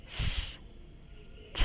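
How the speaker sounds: breath-only S, then t; whispered final sounds. Shown as a demonstration. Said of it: "Các em đọc lại theo cô nha".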